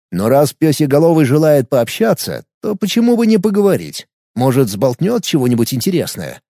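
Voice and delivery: usual speaking voice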